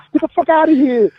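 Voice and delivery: very high, loud thrilled disbelief, re-enacted; happy and excited. Wrestler reacts to writers' title news, high excited disbelief. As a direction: act out giddy disbelief at great news. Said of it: "all happy and excited".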